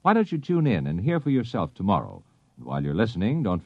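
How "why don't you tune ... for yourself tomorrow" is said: ordinary delivery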